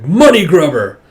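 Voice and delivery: loud jeering jab; loud taunt; mock insult shout. Character insults greedy informant.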